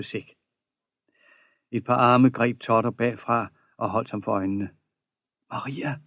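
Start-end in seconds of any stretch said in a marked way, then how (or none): 5.5-6.0 soft, hushed name; happy whisper. A narrator's reading. Totter guesses who covers his eyes, happy whisper. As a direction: breathe her name softly in delight. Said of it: "hviskede han og følte sig fuldstændig lykkelig".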